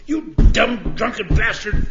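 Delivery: loud, high, raging yell; furious shout. Retold or imitated. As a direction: trustee yelling at the drunk by his bed, furious shout. Trustee finds vomit by bed.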